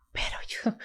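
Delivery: whispered, as if still unable to believe it. Real, not acted. Telling her audience about the Virgin's gift, whispered. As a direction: drop to a breathless whisper on pero yo. Awestruck pause in her story.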